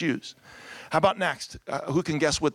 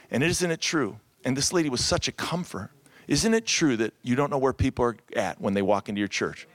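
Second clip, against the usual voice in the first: ordinary talk, nothing marked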